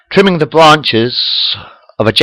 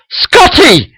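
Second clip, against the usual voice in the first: high, loud cry of surprise; astonished cry; 3 dB louder than usual. A narrator's reading. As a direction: call out the name in shock. Kirk sees Scotty, astonished.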